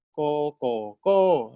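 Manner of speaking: teacher drilling the rising tone; second tone demo. Shown as a demonstration. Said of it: "第二标 gives you 第二声".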